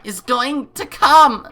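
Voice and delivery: defiant shout. Acted. Girl defies the villain.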